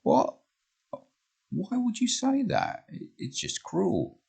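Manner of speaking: a hurt friend asks why, slowly; hurt Jane. Acted. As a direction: let her friend's words sting. Jane hurt by Carla's words.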